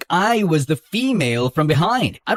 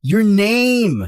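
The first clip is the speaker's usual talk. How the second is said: raised voice shouting; yelling; 5 semitones higher, 4 dB louder than usual. Acted. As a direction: snap it out loud. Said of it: "Don't yell at me".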